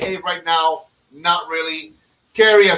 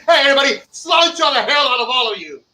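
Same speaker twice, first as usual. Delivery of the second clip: mock Irish toast; 7 semitones higher, faster than usual. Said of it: "if I walked into an Irish bar and I said".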